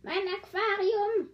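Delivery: high, slow alarmed cry; high dismayed cry over the aquarium. A narrator's reading. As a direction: call it out, dismayed. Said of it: "rief Daka".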